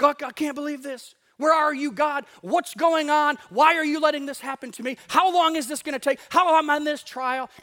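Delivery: loud, high, disbelieving cry to God; mock outburst of demanding, pleading questions; shocked protest. Shocked believer cries out to God in a trial, loud disbelief. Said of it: "We're shocked".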